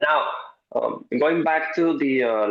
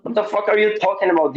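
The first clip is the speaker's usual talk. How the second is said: higher, louder and fast, half-joking exasperation; mock outburst; 8 semitones higher, 4 dB louder, faster than usual. Coach reacting to a client who says I am this, mock exasperated outburst. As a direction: blurt it out like you can't believe what you heard.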